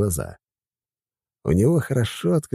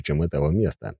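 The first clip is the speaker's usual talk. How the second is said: ordinary delivery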